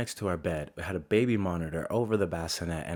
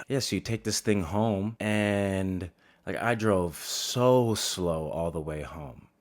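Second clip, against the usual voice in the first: normal talking voice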